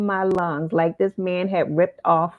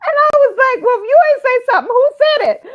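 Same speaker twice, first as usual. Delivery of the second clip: very high, loud voice through laughter; helpless laughter pushing the words up high; laughing disbelief; 9 dB louder, much faster than usual. Real, not acted. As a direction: squeal the retold reply while cracking up. Laughing as she retells her baffled reply.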